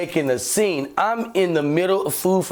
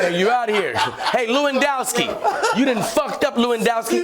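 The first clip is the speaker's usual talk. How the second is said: talking shit; 7 semitones higher than usual. Retold or imitated. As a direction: gloat at the officer. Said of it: "I'm talking shit now".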